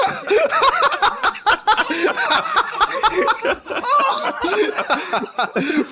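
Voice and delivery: helpless high-pitched laughter; long high laugh. Real, not acted. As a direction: crack up laughing. Huge laugh at aces.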